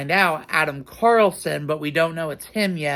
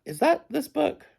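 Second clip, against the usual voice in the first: surprised; 11 semitones higher than usual. Real, not acted. Suddenly doubts the book.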